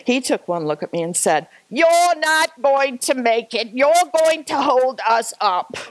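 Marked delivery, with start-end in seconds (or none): 1.7-5.8 loud, high, slow imitation of a complainer; retold scolding. British gentleman to struggling hiker, imitated scolding. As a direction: drag out the complaint, raised in pitch and mock outrage.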